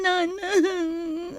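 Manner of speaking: sobbing out the word, voice breaking; tearful broken call for mother; crying. Acted. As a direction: cry it to your mother. Daughter tells mother of trouble.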